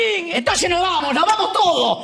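high, shouty Argentine politician farewell; Milei voice. Acted. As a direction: bellow thanks like a fired-up Milei. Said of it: "no te puedes despedir sin hacer el grito libertario típico de Javier Milei".

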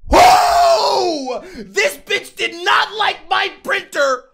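normal talking voice

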